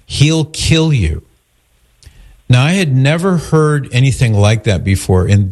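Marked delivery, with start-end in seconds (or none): 0.1-1.2 slow, higher-pitched retold warning; insistent warning. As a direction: warn them slowly, as if not kidding. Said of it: "he was so insistent".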